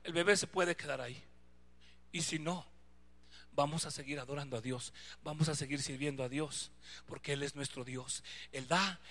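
soft, low, steady; husband softly reassuring his wife, low; quiet resolve to wife. Retold or imitated. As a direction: calmly tell her you'll keep trusting. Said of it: "Yo le dije a mi esposa".

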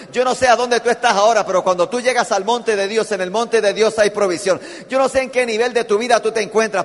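loud, driving preacher's call; preaching at full force. Acted. Preacher urging the congregation, loud and driving. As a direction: press the promise home to the congregation.

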